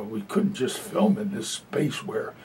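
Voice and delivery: ordinary delivery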